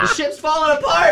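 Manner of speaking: high, laughing panicked cry; mock panic. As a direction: cry out like the ship sinks.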